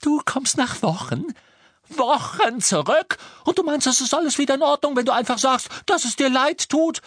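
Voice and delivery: very high, loud, incredulous tirade; shrieking indignation. Acted. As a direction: scream the questions in outrage. Hermine tobt über Rons Entschuldigung.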